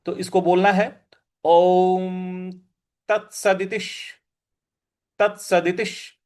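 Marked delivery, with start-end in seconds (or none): 1.4-6.2 ओम तत्सदितिश recited slowly with stress on त; careful recitation. Shown as a demonstration. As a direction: enunciate तत्सदितिश syllable by syllable. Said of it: "इस प्रकार से बोलेंगे".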